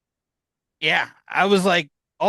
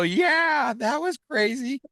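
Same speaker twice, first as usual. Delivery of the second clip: retold amazed agreement; 11 semitones higher, slower than usual. Retells excited reply to cameraman.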